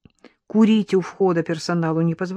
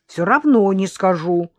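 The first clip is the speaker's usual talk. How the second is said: stubborn; 3 semitones higher, 7 dB louder than usual. A narrator's reading. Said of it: "упрямо повторила Кася".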